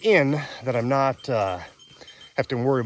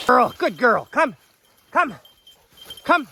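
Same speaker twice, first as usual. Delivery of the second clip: calling the dog; 12 semitones higher, 6 dB louder, slower than usual. Real, not acted. Praising dog.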